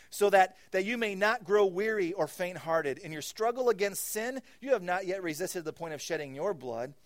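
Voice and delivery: high, loud impassioned preaching; preaching at full force. Real, not acted. Pastor urging the congregation, loud impassioned preaching. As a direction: proclaim it with fire.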